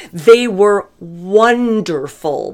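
drawn out and heartfelt; slow, emphatic praise. Real, not acted. Praising the in-laws' kids to viewers, slow and emphatic. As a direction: stretch every word of it.

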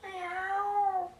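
high, thin little mewing; kitten voice. Acted. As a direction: mew like a tiny scared kitten. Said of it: "hat's gemacht gehabt".

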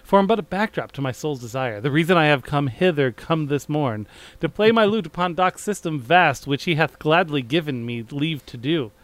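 lightly theatrical verse reading; light Shakespearean reading. Acted. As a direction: read the old verse with a touch of stage flourish. Reads his Shakespearean script aloud.

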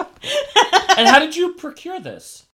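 high, slow, drawn-out questioning; hammed-up suspicious inquiry; mock interrogation. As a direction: draw the question out loudly, mock-grand. Quotes an interrogation about stolen goods.

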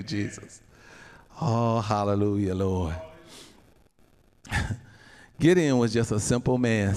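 usual speaking voice

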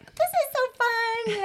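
high, slow mock cheer; sarcastic. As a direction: chaperone mocking the miserable wet kids, high and slow. Sarcastic line among crying kids.

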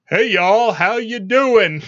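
mock Tennessee bumpkin greeting; idiot voice. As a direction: put on a hammed-up yokel idiot. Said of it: "how would I talk if I was an idiot?".

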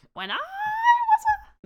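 put-on very high, slow, quavering old man. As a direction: whine it out like a creaky old geezer. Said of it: "that's my impression of your impression of an old man".

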